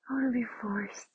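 soft unvoiced whisper. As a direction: breathe it as a whisper. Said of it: "same words, whispered take".

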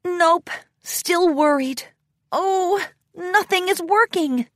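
high, mildly fretful voice; a touch of worried exasperation, pitched high; frustrated. A narrator's reading. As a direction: fret to yourself that it is hopeless. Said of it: "shook her head in frustration".

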